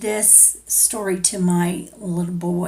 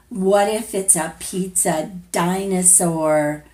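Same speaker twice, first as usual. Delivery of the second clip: ordinary delivery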